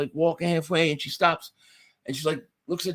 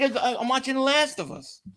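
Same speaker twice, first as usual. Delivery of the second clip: flustered excuse; 8 semitones higher than usual. Retold or imitated. Retold excuse to wife.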